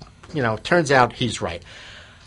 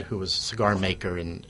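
ordinary talk, nothing marked

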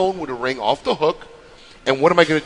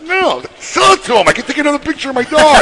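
hammed-up higher-pitched squeal of disbelief; mock high-pitched retort; 13 semitones higher, 8 dB louder than usual. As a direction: play the wife in a high, loud mocking voice. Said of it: "It's like".

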